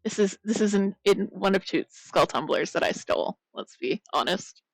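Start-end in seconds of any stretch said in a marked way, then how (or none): none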